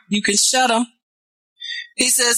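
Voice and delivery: ordinary talk, nothing marked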